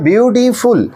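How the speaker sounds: "Beautiful" drawn out in separate beats; broken into syllables. Shown as a demonstration. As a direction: split "beautiful" with little stops between syllables. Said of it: "थोड़ा सा रुके".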